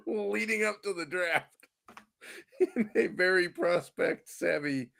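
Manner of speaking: can't keep from laughing; chuckling through the punchline of his story; laughing through words. Real, not acted. Laughing while telling funny story.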